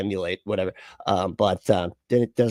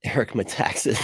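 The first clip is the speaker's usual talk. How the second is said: ordinary talk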